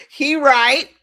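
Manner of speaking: delighted agreement. Real, not acted. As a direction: crow it with glee. Repeats with delight.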